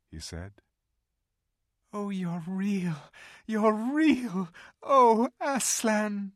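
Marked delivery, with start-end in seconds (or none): 1.9-3.1 higher, slow, wondering joy; joyful cry. Acted. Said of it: "cried Lucy". 3.5-6.3 much higher, louder, slow cry of relief; joyful cry. Acted. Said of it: "cried Lucy".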